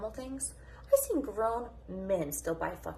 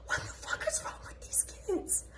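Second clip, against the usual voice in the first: incredulous whisper; 11 semitones higher, 5 dB quieter than usual. Retold or imitated. Retells thoughts listening in on kids.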